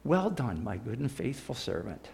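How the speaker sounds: quiet, tender praise; tender Jesus. Retold or imitated. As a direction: hush it like a kind blessing. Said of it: "Jesus standing there saying".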